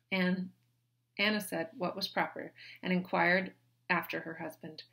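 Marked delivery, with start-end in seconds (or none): none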